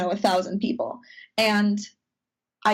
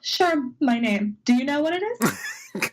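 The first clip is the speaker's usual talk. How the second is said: 1 sarcastic self-mockery; 5 semitones higher than usual. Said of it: "And I was like".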